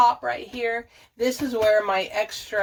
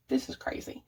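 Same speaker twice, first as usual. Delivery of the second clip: whispered aside; 3 semitones higher, 8 dB quieter than usual. Real, not acted. Overwhelmed by messy closet.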